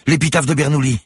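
very high, loud announcement; excited cry. A narrator's reading. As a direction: shout the find to someone far off. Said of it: "s'écria Manon". (cri de découverte, aigu et fort)

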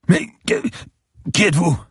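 a beaten lawyer gasping in fear; frightened bleeding man pleading with the cop; terrified captive. Acted. Beaten man voiced in thriller.

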